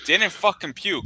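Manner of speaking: ordinary talk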